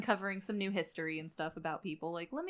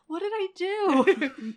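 high slow whimpering plea; whiny brother; 9 semitones higher, 5 dB louder, slower than usual. Retold or imitated. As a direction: whine it like a hurt little brother. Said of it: "He's gonna go".

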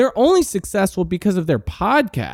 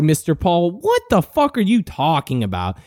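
normal talking voice

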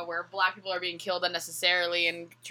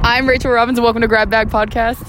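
outdoor effect voice; 4 semitones higher, 13 dB louder than usual. Acted. Intro retake, other setting.

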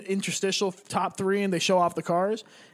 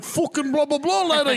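hammed-up Irish shout, higher; a big drunk Irishman calling out in a raised Irish accent; imitating Irish drunk; 7 semitones higher than usual. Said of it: "It's like".

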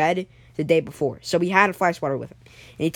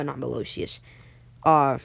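usual speaking voice

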